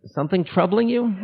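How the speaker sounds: a lightly voiced guru, amused and gentle. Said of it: "he looks at me, says".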